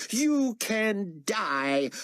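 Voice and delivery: very slow, high pronouncement; chilling slow doom; cold verdict. A narrator's reading. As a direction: say it like a death sentence. Carpathia sentences Moon.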